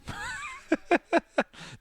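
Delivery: a very high, squeaky burst of laughter; squealing laugh. Real, not acted. Remembering the mate getting mowed, squealing laugh. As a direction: let a giggle squeak out.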